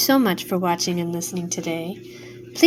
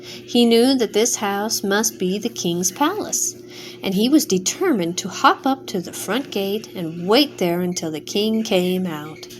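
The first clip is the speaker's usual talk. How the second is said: usual speaking voice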